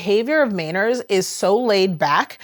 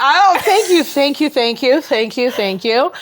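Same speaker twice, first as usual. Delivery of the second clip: mock gracious thanks; 6 semitones higher, 6 dB louder, slower than usual. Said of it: "I bow".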